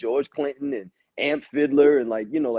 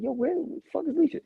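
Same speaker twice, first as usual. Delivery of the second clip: much higher, mock baffled; suddenly notice she's gone; surprised; 14 semitones higher, faster than usual. Retold shock at Alicia's absence.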